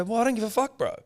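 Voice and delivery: high, heated comeback; heated retort. Retold or imitated. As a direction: snap it back hot. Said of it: "I go".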